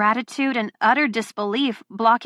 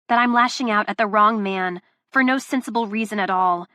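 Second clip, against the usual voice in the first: usual speaking voice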